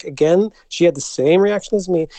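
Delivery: ordinary delivery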